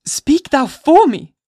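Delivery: very high, loud, slow desperate plea; crying out. A narrator's reading. Hester begging Dimmesdale to speak for her, crying out. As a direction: cry out to him for rescue. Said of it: "cried she".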